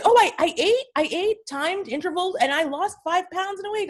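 high, eager report; imitating excited client. Client reporting weight loss to her, excited. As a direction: gush like a proud client.

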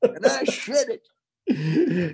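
high, slow squeaky drawl; put-on podcast voice. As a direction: draw it out in a silly high voice. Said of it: "just did it that way".

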